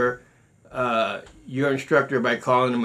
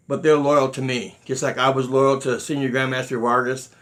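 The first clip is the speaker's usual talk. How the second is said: usual speaking voice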